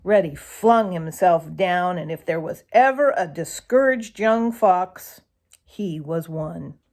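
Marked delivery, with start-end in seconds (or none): none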